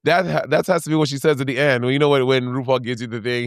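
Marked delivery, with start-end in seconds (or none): none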